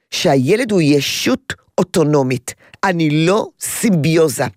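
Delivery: normal talking voice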